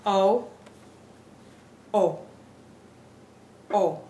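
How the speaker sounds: O short and pure, with no glide to "oo"; O cut short, each one separated by a pause; crisp Spanish O. Shown as a demonstration. Teacher modelling the Spanish vowel, O said crisply. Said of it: "make it crisp".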